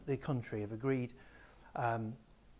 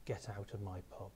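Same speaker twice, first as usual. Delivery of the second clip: softer, lower "Get out of my pub" leaned in close; quiet warning; 5 semitones lower, 8 dB quieter than usual. Retold or imitated. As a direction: murmur the threat so only he hears. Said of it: "like that".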